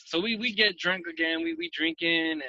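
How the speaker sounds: normal talking voice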